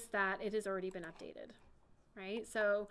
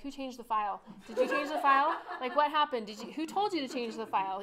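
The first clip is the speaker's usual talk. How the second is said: mock panicked office workers, voice jumping up and louder; alarmed coworkers; 3 semitones higher, 6 dB louder than usual. Coworkers demanding who changed the file, mock alarm.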